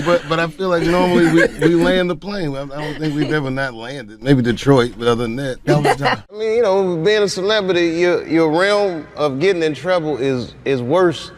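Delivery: ordinary delivery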